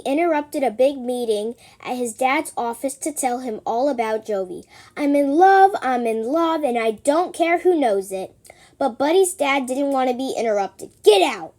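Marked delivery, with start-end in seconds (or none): 5.0-8.4 loud, giddy announcement; gushing in love. Acted. Buddy interrupting his dad's meeting, loud and giddy. 11.0-11.6 angry shout. Acted. Dad yells at Buddy.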